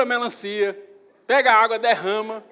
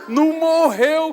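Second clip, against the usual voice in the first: laughing; 7 semitones higher, 7 dB louder, slower than usual. Real, not acted. Pastor jokes about spanking son.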